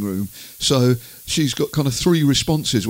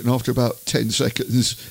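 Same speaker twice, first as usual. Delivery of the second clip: ordinary delivery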